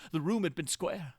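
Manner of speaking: plain reading voice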